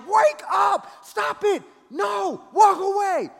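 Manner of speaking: high-pitched frantic shout; desperate shouting. Acted. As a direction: shout as if waking yourself from a trance. Wants to shout himself awake.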